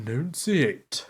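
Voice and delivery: slow, drawn-out echo; mock enunciation. Teasing the co-host about enunciating, slow mock-precise echo. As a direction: stretch every syllable mockingly. Mocking echo of co-host's word.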